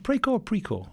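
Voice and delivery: "Precor" tried two ways, questioning; unsure pronunciation. Real, not acted. Host asks guest how the company is said, unsure pronunciation. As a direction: check how "Precor" is said, trying both vowels. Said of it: "I've been saying it wrong".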